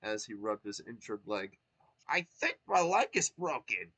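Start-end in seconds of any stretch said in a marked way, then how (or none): 2.1-3.9 pained Toad. Acted. Injured Toad.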